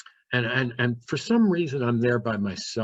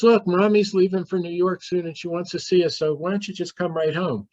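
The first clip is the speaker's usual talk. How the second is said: high, bright and breezy, much higher; cheery party voice; 7 semitones higher, 3 dB louder than usual. Retold or imitated. Sister on the phone to her brother, faking a higher party cheer. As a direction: sister brushing it off like all's well at a party. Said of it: "She sounds like she's at a party".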